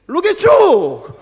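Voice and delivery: "look at you" high, loud and very slow in a North American accent; buttery North American accent. Shown as a demonstration. Pastor demonstrating the buttery North American accent to the congregation. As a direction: model the accent for everyone to copy. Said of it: "한번 해봐요".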